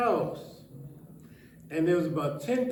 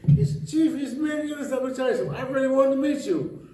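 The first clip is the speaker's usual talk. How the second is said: prisoner's voice; 8 semitones higher, 5 dB louder, faster than usual. Retold or imitated. Prisoner voiced in retold story.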